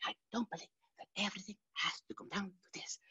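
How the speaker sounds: lively imitation of a Sicilian friend; dynamic Sicilian friend. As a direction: swing from shout to hush. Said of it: "She whispers, she shouts, and she says".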